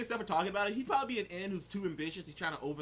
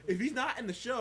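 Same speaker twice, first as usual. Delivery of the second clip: repeated higher and louder; 7 semitones higher, 3 dB louder than usual. Shown as a demonstration. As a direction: push it up with emphasis. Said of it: "repeated louder".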